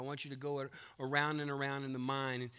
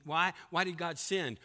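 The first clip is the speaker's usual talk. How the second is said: ordinary talk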